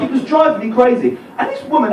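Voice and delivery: ordinary delivery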